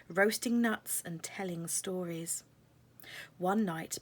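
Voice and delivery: plain reading voice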